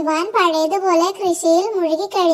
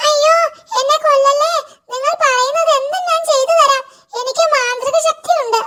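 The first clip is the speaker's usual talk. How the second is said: panicked imp pleading; 9 semitones higher than usual. A narrator's reading. Caught imp begging Ivan to spare him, high squealing panic. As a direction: shriek and plead like a caught little devil.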